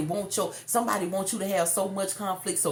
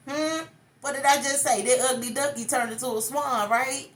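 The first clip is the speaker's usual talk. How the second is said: teasing; 7 semitones higher than usual. Real, not acted. Reminding the listener, high and teasing. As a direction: playfully call them out.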